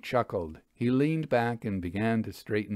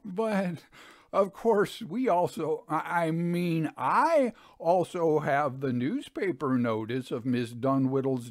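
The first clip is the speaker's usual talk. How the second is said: higher, stumbling, lightly voiced swindler; flustered Lavelle; 5 semitones higher than usual. A narrator's reading. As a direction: scramble to recover the lie.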